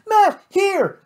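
high, loud call across the room; cried out. A narrator's reading. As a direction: Kate calls to Matt, high urgent cry. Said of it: "cried Kate".

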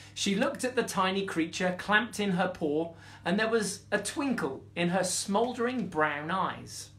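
usual speaking voice

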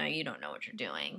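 ordinary delivery

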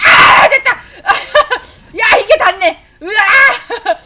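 high, loud whoops of victory, laughing; laughing ecstatic yelling; triumphant shout. Real, not acted. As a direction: scream with joy at the big hit. Landed a hard skill shot.

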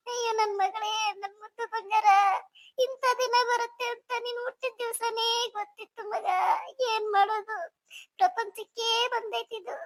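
keening, weepy farewell; tearful wailing. Acted. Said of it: "ಚಿಕ್ಕಮ್ಮ, ಸಮಾಧಾನ ಮಾಡ್ಕೊಳ್ರಿ".

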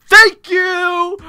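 high, loud, drawn-out cry of relief; exclaimed vindication. Retold or imitated. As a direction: shout it like you've finally been vindicated. Retold relief that cop agrees.

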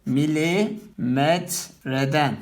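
milimetreden said very slowly; slow teacher's dictation of milimetreden; slow demo. Shown as a demonstration. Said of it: "Bunu nasıl telaffuz ettim?".

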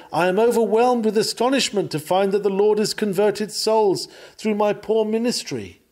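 high, loud mock astonishment; mock humility. Preacher imitates ministers amazed at their converts, mock humility. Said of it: "Mock humility".

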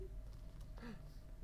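breathy surprised gasp. Acted. Teacher showing children the planets, a wondering gasp.

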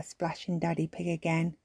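ordinary delivery, nothing marked

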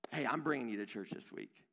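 matter-of-fact insistence. Acted. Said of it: "there's a difference when we say".

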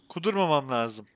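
ordinary talk, nothing marked

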